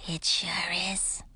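slow, breathy whisper. A narrator's reading. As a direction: breathe it out softly. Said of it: "chimed in a breathy voice".

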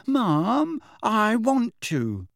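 high, drawn-out pleading whine; wail. A narrator's reading. Youngest daughter pleading with her mum to stay, wailing. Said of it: "wailed Ginny".

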